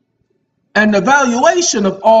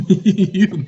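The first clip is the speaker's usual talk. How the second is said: laughing. Real, not acted. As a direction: chuckle into the word. Laughing at own teasing remark.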